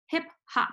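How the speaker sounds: "Hip hop" with both words stressed equally. Shown as a demonstration. Teacher demonstrating a spondee, equal weight on "Hip hop". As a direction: give "Hip" and "hop" the same weight, separated. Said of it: "two syllables that have equal weight to them".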